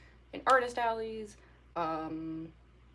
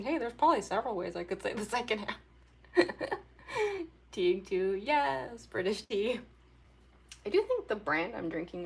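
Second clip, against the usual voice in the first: ordinary talk, nothing marked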